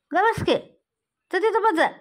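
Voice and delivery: high, loud shout to go sit. Real, not acted. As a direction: shout the kitten back to its spot. Shooing the kitten.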